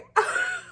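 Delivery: real high-pitched laughing fit; tickled laughter. Real, not acted. Tickled by the restaurant worker's saying, helpless laughing. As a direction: crack up helplessly at your own impression. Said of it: "I just got so freaking tickled".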